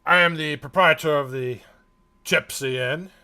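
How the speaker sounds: loud, proud dwarf voice. Acted. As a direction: announce it like a proud host. Dwarf introduces himself.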